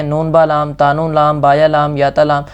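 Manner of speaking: نون با لام run together quickly; rushed reading. Shown as a demonstration. Teacher imitating hasty wrong reading. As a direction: gabble the letters. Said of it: "اس طرح سے نہیں پڑھنا".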